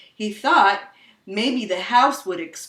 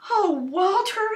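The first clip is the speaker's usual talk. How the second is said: put-on a child's high sigh at her dog; lightly voiced young girl; gentle high plea; high little girl voice; 11 semitones higher than usual.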